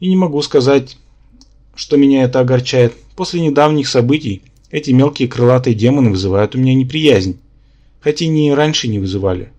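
usual speaking voice

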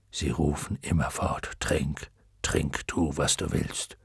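put-on deep, soft old dragon voice; luck dragon. A narrator's reading. Luck dragon explaining the waters to Atreju, low. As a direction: let the dragon speak low and gentle.

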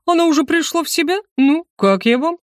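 живой хвастливый гость, повыше; похвастайся своей затеей; бодрый голос. Acted. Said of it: "знакомый бодрый голос". (бодро и самодовольно)

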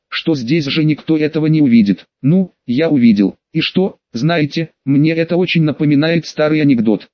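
ordinary delivery

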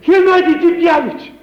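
high, loud joyful hail; loud greeting. Acted. Arkashka loudly hails Gennady Demyanovich in surprise.

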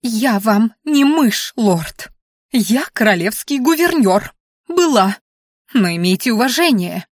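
huffy, emphatic self-defence; indignant. A narrator's reading. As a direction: correct the lord with offended dignity. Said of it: "вызывая новый поток возмущения".

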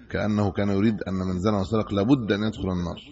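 ordinary delivery

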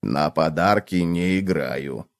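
low, slow, stolid refusal; low, soft, immovable cockroach voice; firm cockroach. A narrator's reading. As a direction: dig in quietly, low and drawn out. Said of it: "твёрдо ответил таракан".

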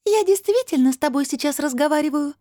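very high, ringing disembodied voice; crystal-bell voice. A narrator's reading. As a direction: answer in a tiny crystal-bell pitch. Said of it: "словно тысячи хрустальных колокольчиков". (очень высокий хрустальный голос)

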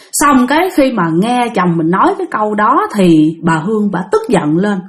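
ordinary delivery, nothing marked

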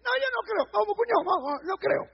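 high, dismissive scoffing voice; mocking unbeliever. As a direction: mimic a careless unbeliever brushing it off. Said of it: "los incrédulos". (imitando a un incrédulo burlón)

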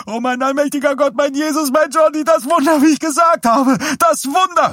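very high, loud religious shrieking; hysterical rapture. A narrator's reading. Wife cries out to God about the miracle, hysterical. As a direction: shriek it in ecstatic frenzy.

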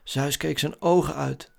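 ordinary delivery

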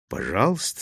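low, soft, unruffled; cool and understated; calm reply. A narrator's reading. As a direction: answer a furious man with quiet composure. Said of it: "спокойно ответил он".

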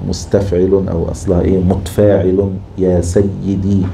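usual speaking voice

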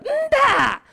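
high scornful no; squeaked high no. Acted. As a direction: squeal the denial mockingly. Preacher rejects boast.

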